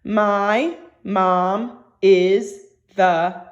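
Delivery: each word spaced far apart, very slow; very slow dictation. Shown as a demonstration. Teacher to young students, dictating the new sentence slowly. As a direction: dictate it slowly so children can write. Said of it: "Let's do it again".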